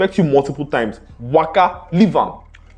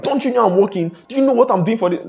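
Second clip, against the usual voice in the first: put-on irritated boyfriend; 9 semitones higher than usual. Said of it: "logically answering her like".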